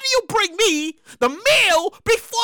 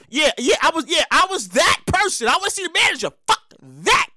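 ordinary talk

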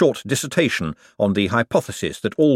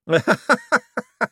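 giddy laugh. Acted. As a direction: giggle growing into a laugh.

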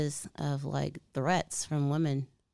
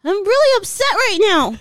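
mock angry; 13 dB louder than usual. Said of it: "how can I tell if you're angry".

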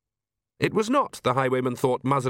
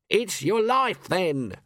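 swaggering gentleman highwayman; 7 semitones higher, much slower than usual. Acted. Highwayman threat.

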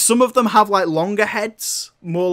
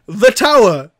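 louder pointing cry; mock exclamation; 4 semitones higher, 7 dB louder than usual. Luigi pointing at the tower, loud mock cry. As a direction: announce it like a big reveal. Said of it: "points at the tower and says".

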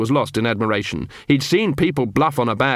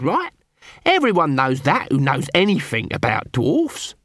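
a hint of gruff dwarf and squeaky Nobby; lightly voiced watchmen; 5 semitones higher than usual. A narrator's reading. Cuddy quizzing Nobby, lightly voiced characters. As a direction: trade lines in two light character voices.

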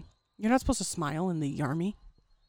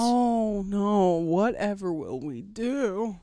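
slow, drawn-out fake despair; hammed-up damsel-in-distress lament; mock dismay; 4 dB louder, much slower than usual. Teasing friend about the buffering video, mock dismay.

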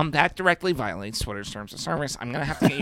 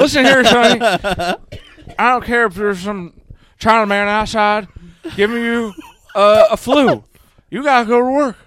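put-on higher, louder crotchety old-man scolding; Joe Biden impression; 7 semitones higher, 7 dB louder than usual. Mock Biden lecturing a young man about stimulus money, high and loud. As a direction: scold him like a grumpy grandpa president. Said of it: "this is my Biden".

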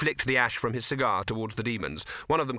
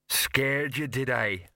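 hopeful troll; 3 semitones higher, much slower than usual. A narrator's reading. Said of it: "said Detritus hopefully".

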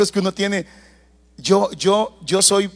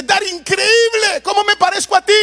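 amazed exclaim; 12 semitones higher, 4 dB louder than usual. Retold or imitated. Son amazed, retold by father.

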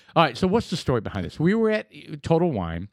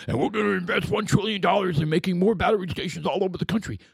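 lightly voiced president, a touch higher; mock speech voice of the president; quoting the president; 5 semitones higher than usual. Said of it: "he was like".

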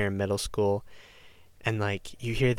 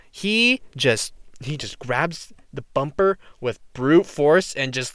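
ordinary talk, nothing marked

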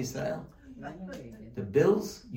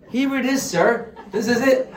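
high, loud and proud with a laugh; cow presenting wardrobe; 7 semitones higher, 5 dB louder than usual. Acted. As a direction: play the cow showing off, higher and louder.

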